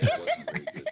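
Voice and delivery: high squeal of laughter at the joke; laughing. Real, not acted. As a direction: laugh it out high, through the words. Laughing at own bad joke.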